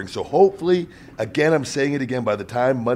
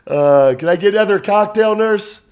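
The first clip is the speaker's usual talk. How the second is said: joking request; 8 semitones higher, 6 dB louder than usual. Pretend. Joking request from hospital bed.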